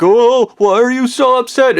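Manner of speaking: high-pitched mock concern; imitating the wives. Wives to the upset speaker, imitated high fussing. Wives react to his outburst.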